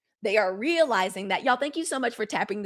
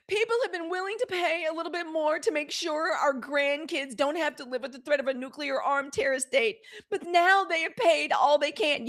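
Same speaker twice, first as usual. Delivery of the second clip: higher, hammed-up earnest pundit; acting her out; 6 semitones higher than usual. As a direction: act out her serious face and plea. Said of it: "I still get to act for y'all".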